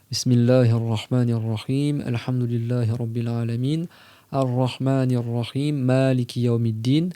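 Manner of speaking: slow, chanted recitation; Quran recitation. Acted. Teacher reciting the Fatiha to his listeners. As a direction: intone each verse slowly and reverently.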